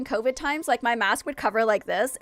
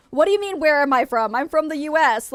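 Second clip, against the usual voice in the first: louder, slightly higher indignation; indignant retort; 4 semitones higher, 7 dB louder than usual. Acted. Korean American answering where she's from, indignant. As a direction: push back, affronted.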